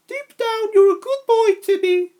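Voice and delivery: high, loud and fond mother voice; fond smile. Acted. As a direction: praise the boy with a big smile. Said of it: "his foster mom smiled".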